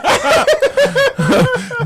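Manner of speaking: shrill burst of laughter; real squealing laugh; high squealing laugh. Real, not acted. Laughing at directions bit.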